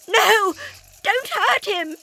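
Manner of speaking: high, loud desperate plea; begging. Acted. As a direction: beg for his life. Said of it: "Zoe begged".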